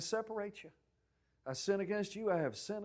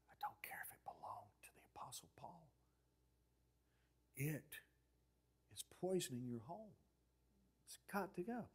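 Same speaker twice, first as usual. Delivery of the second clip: very hushed, firm whisper; insistent whisper; 13 dB quieter than usual. Retold or imitated. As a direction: whisper the warning, word by word.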